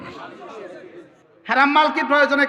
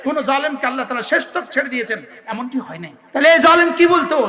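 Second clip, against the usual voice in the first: ordinary talk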